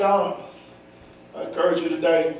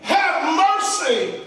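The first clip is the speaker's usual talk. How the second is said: high, ringing cry in separate bursts; high, drawn-out plea; hollering at full lung; 9 semitones higher than usual. Acted. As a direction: cry out for mercy, settling on the last words. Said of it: "hollers to the top of his lungs".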